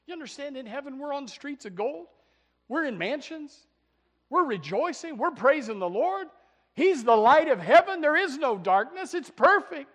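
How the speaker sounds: exultant preaching. Acted. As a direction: lift into joyful celebration. Rising exultant heaven preaching.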